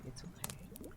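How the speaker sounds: soft breathy ASMR whisper. Shown as a demonstration. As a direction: whisper it close to the mic. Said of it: "A S M R maybe tonight".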